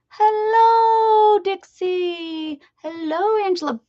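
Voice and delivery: high, loud stretched hello; sing-song hello. Real, not acted. Greeting viewer Dixie in chat, high loud sing-song hello. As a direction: call out the greeting happily.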